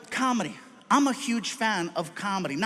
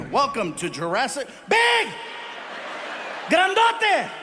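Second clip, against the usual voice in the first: booming announcement; 6 semitones higher than usual. Real, not acted. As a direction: hype up a giant like a ring announcer. Mocking the huge bodyguard.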